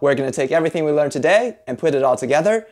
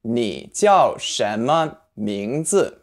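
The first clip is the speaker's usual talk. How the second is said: 你叫什么名字 said very slowly, every syllable drawn out; very slow model; much slower than usual. Shown as a demonstration. Teacher modelling the question slowly for learners. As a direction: lay out each syllable slowly. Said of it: "To say, "What's your name?".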